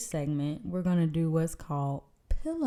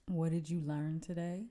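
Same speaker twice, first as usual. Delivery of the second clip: soft, drowsy question; sleepy voice; 9 dB quieter than usual. Pretend. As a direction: murmur it like pillow talk. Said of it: "we doing our sleepy voice".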